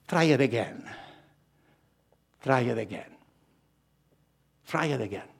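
love patiently encouraging someone who failed; gentle encouragement. Acted. Love's gentle encouragement voiced.

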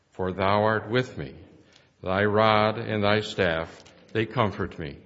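ordinary delivery

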